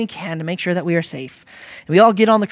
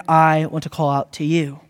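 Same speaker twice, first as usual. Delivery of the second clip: normal talking voice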